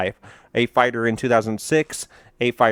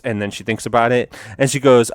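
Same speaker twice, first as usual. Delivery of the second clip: ordinary talk, nothing marked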